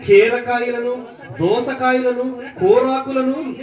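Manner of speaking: slow, drawn-out recital of a wailed complaint; mock-lamenting scripture reading. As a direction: read it slowly, stretching each craved food as the list climbs. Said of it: "ఏమని ఏడుస్తున్నారంటే". (విలపిస్తున్నట్టు సాగదీస్తూ నెమ్మదిగా చదవడం)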